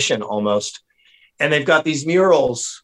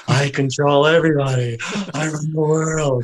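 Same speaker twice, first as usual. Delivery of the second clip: crazed megalomaniac impression; 2 semitones higher than usual. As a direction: gloat like a hammed-up lunatic tyrant.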